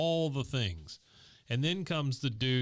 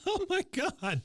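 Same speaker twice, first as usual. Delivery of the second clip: high laughing exclaim; slower than usual. Real, not acted. Laughing at the broom gag with his co-host. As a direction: burst out in disbelief and laughter.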